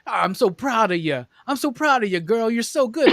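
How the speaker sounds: high, gushing praise for a dog; doting praise. Retold or imitated. Imitating the opening guy praising his dog, high and gushing. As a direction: coo over your dog like a proud owner.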